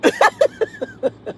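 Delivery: shrill burst of giggles; real giggle fit; high squealing laugh. Real, not acted. Laughing at comment.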